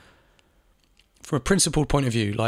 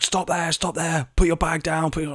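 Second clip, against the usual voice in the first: higher, barking commands to stop; barking police orders; 6 semitones higher than usual. Retold or imitated. Police officer stopping the narrator, barking commands. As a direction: snap orders at a man who did nothing. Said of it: "barking orders at me".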